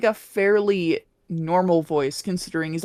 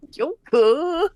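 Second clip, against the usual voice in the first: Morgana voice; 4 semitones higher, much slower than usual. Retold or imitated. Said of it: "in the Morgana voice".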